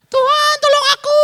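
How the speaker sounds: teriakan suara perempuan tinggi melengking; suara wanita. Acted. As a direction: panggil Tuhan dengan suara wanita yang jauh lebih tinggi. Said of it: "Oh, suara wanita, ganti dulu". (wanita pendarahan berseru kepada Tuhan, suara perempuan tinggi)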